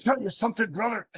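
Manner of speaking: high, hammed-up wrestler catchphrase; wrestler promo voice. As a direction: growl it like a pro wrestler. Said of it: "There it is".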